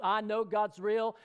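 normal talking voice

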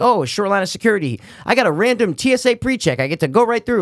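high mocking smug voice; smug lucky traveler. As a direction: gloat like the lucky ones. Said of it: "always have good luck traveling".

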